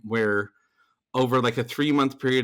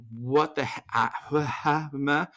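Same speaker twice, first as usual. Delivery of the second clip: mock speechless stammer; comic bewildered babbling; retold dumbfounded sputter; 2 semitones higher, slower than usual. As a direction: sputter as if words fail you. Acting out dumbfounded reaction afterwards.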